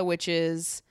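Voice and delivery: ordinary talk, nothing marked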